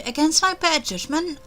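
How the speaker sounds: ordinary delivery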